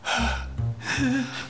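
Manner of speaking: sharp high theatrical gasp. Acted. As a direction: gasp in mock fright. Reacting to scary story.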